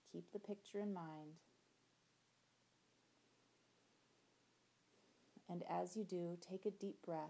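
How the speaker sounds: gentle visualization voice, soft endings; guided meditation. Acted. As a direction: guide them through the imagery calmly.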